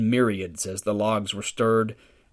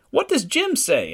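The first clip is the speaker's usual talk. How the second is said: put-on anxious old lady. Old lady anxiously asking son.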